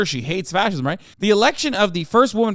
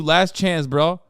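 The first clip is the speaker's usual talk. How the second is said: ordinary talk, nothing marked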